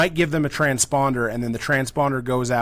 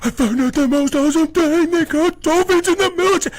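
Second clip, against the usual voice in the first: high, loud, giddy calling out; excited yelling; 14 semitones higher, 3 dB louder than usual. Retold or imitated. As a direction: shout up the stairs like a thrilled kid. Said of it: "I was like".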